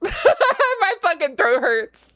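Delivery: slow, laughing through the words. Real, not acted. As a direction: laugh while complaining. Repeats complaint laughing.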